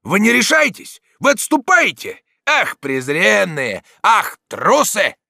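high, loud, scornful yells; taunting shout. A narrator's reading. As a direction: taunt retreating cowards, drawing out the insults. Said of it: "закричал Дон Фернанд". (презрительный крик)

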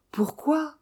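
an incredulous question, thin and high-pitched; astonished question. A narrator's reading. Eden asking about the poisoned parrot, high hushed exclamation. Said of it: "s'écria Bob Eden".

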